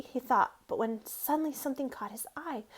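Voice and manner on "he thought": usual speaking voice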